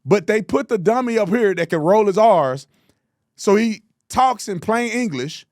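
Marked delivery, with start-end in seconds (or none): none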